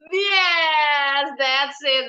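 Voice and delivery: normal talking voice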